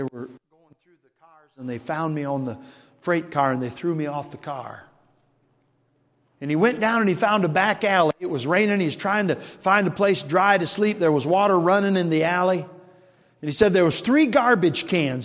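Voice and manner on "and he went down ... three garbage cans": louder, higher storytelling build; building sermon narration; rising preacher's storytelling. Acted. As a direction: start lifting the story toward the point.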